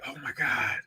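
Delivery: hushed, awed whisper; stunned whisper. Retold or imitated. Taking in mom's witch tale, stunned whisper. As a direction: whisper it as it sinks in.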